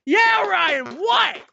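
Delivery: high, exasperated answer; mock frustrated. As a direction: act out impatience with an interrupting child. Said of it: "when I started getting, like, frustrated".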